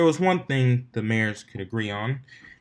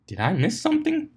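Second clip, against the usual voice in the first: puzzled; 12 semitones higher than usual. A narrator's reading. Ponies' shared puzzled expression.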